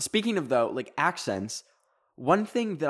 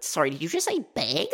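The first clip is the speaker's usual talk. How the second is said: higher-pitched mock disbelief; incredulous American; 6 semitones higher, faster than usual. Americans pointing out her accent, mock-incredulous higher voice. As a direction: imitate someone pouncing on an odd pronunciation. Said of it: "so many Americans would, like, point it out".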